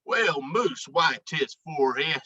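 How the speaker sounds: ordinary delivery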